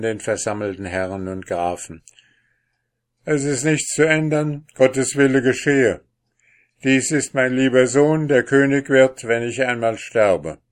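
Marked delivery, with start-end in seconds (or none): 3.2-10.6 louder, higher solemn proclamation; proclaiming king. Acted. The king announces his new son to the court, loud and solemn.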